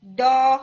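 ڈو said loud and hard, like an English D; wrong D-like sound. Shown as a demonstration. Teacher to students, showing the wrong D-like ڈو. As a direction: show the mistaken ڈو with a D sound. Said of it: "تو ہماری sound کیسے آتی ہے؟".

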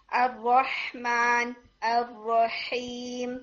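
الرحمن الرحیم drawn out slowly, breathy throat ha; teacher modelling the ha sound; slow tajweed demo. Shown as a demonstration. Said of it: "جیسے for example".

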